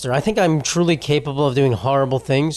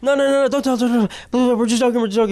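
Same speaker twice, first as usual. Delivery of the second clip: high, tumbling panicked pleading; mock panic; 8 semitones higher, faster than usual. Kids begging a classmate not to tell the teacher, acted-out panic. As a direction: imitate a panicked kid backpedalling. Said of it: "like, panicking".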